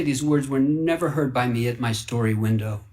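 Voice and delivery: ordinary delivery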